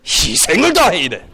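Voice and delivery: hammed-up Korean drama accent; imitated drama elder's accent, swelling high. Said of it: "I use that accent".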